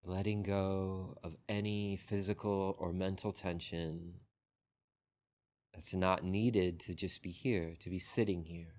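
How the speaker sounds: unhurried meditation guidance with long pauses; guided meditation. Real, not acted. Teacher guiding a group into breath and body scan, unhurried meditation voice. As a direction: lead the sitters gently, phrase by phrase, leaving long silences.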